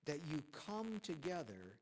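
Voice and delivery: "come together" drawn out slowly; stress on "come together". Shown as a demonstration. Preacher reading Paul to the church, stressing "come together". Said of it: "I'll emphasize the word".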